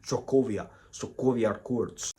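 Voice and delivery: mock Russian accent, low and muttered toward a slow finish; put-on Russian accent, understated; Elizabeth Olsen's Russian accent. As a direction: poke fun at a bad movie Russian accent. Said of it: "I think her Russian accent in the Avengers was terrible".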